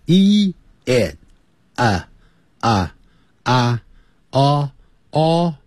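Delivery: "一、哎、啊、哦" cut into single sounds; separated vowels. Shown as a demonstration. Teacher cutting the vowel chain apart, one sound at a time. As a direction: say each vowel "一、哎、啊、哦" separately with a pause between. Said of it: "切开的时候".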